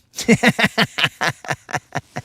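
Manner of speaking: loud, long run of cackling laughter; laughing. Real, not acted. Angler to Creel after barely getting it, laughing. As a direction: burst out laughing and let it trail away.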